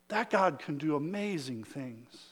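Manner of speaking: a soft, awed undertone; hushed awe. Shown as a demonstration. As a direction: drop it to a near hush in wonder. Repeated line, dropped to hush.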